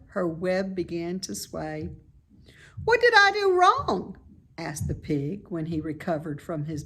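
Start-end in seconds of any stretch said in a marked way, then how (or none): 2.8-4.2 high, loud piggy voice; a young pig, eager and impressed; bright squeaky pig voice; Wilbur the pig. A narrator's reading. Wilbur puzzled.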